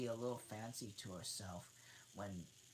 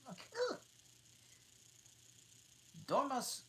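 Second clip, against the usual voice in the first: plain reading voice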